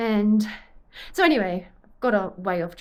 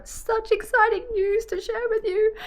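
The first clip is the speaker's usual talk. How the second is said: high, giddy sing-song; playfully squealed announcement; excited teasing; 3 dB louder than usual. Real, not acted. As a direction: tease big news in a squeaky high pitch. Teasing the viewers with coming news.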